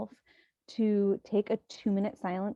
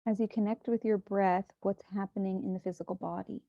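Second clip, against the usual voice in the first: softer, unhurried meditation voice with long pauses; hushed, even meditation guidance; guided meditation; 4 dB quieter than usual. Acted. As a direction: guide listeners gently into breath and body.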